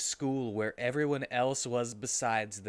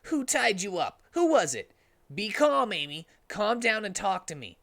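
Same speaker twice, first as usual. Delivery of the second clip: higher, a bit louder hero voice; raised Sonic voice; 9 semitones higher, 4 dB louder than usual. Acted. Sonic asks Amy who tied her, raised voice. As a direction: push up as concerned Sonic.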